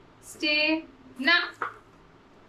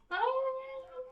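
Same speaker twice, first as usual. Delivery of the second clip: high coaxing call; 11 semitones higher, 10 dB quieter, much faster than usual. Real, not acted. Coaxing the dog to come.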